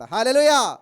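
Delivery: loud, ringing hallelujah call; hallelujah shout. Real, not acted. Preacher shouting hallelujah to the congregation. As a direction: call it out to the whole church.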